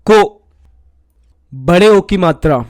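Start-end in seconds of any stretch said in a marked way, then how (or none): 0.1-0.2 को said clearly as ko; syllable demo. Shown as a demonstration. Teacher demonstrates ko to pupils. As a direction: sound out को distinctly. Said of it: "तो उच्चारण होगा".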